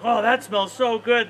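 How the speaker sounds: drawn-out delight at a delicious smell; excited horse. Acted. Parent voicing a horse smelling fresh bread, excited.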